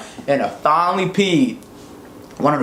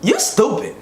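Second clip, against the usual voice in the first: higher, deadpan scolding doctor; doctor's put-down; 9 semitones higher than usual. Retold or imitated. Doctor to the boy who forgot, higher put-down. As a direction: flatly tell him he's dumb. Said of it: "She looked at me, she was like".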